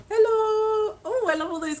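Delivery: very high, sing-song greeting; excited hello. Real, not acted. Streamer spots a viewer sending kisses, squeals a high hello. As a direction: chirp a delighted hello to a viewer.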